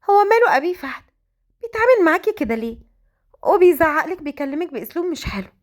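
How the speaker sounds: lightly voiced, high girl's questioning; complaining young sister. A narrator's reading. Sister asks mother about her brother, lightly voiced complaint.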